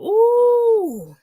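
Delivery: loud, high, long exasperated whoop; irritated "ooh". Real, not acted. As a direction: let the outrage burst out in one long ooh. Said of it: "that irritates me".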